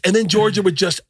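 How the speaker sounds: ordinary delivery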